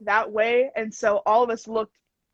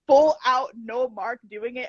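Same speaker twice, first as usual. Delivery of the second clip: ordinary talk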